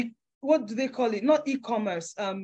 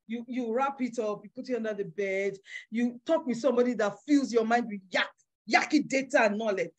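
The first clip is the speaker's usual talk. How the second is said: usual speaking voice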